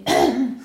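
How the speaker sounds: a quick clearing of the throat. Real, not acted. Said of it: "Ich habe irgendwas im Hals irgendwie".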